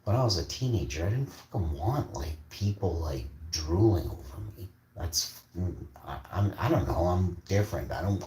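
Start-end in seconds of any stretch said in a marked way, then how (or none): none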